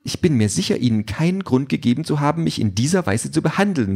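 high, swooping offended voice; indignant drone. A narrator's reading. Drone protests at Peter's treatment, indignant. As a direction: protest as a wounded service robot. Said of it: "sagt die Drohne empört".

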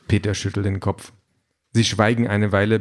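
ordinary delivery

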